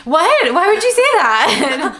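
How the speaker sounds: high, loud, slow indignant what; mock defensive surprise. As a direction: play caught-out innocence, overdone. Bella acting defensive to cop dad.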